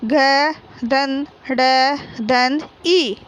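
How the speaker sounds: slow, deliberate घ धन ढ धन ई; teacher spelling घड़ी slowly for children. Shown as a demonstration. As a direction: build the word letter by letter. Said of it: "ये क्या है".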